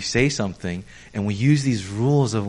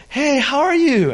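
high, bright-pitched "how are you"; mock Korean greeting; 12 semitones higher, 3 dB louder than usual. Pastor voices a Korean greeting him, pitched high. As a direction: chirp the friendly hello.

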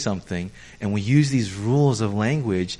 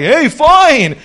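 much higher and louder "fine"; chipper answer; 12 semitones higher, 10 dB louder than usual. Retold or imitated. Pastor plays his clueless self, loud and high. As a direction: blurt it out happily, clueless. His own reply acted out.

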